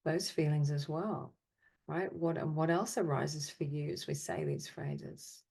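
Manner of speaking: ordinary delivery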